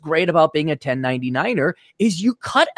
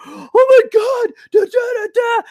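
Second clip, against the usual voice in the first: much higher, slow, whining mock panic; whiny panicked voice; 5 dB louder than usual. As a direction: squeal it like a panicking man-child. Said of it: "coming running to me".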